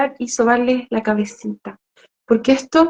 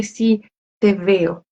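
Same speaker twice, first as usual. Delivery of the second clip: put-on slow, soft, tender words to a child; tender comfort; 3 semitones lower, 3 dB quieter, slower than usual. Shown as a demonstration. Adult comforting a crying child, slow soft tenderness. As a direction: soothe the hurt child gently.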